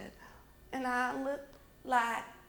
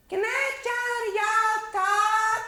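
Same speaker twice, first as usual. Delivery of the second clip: high, loud and slow sing-song; calling upstairs; 10 semitones higher, 7 dB louder, slower than usual. Acted. Mama calling up to Stella, high and slow. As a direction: call the question up the stairs.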